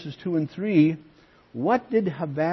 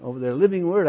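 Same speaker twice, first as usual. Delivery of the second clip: eager retelling; 7 semitones higher, 4 dB louder than usual. Retold or imitated. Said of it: "He says".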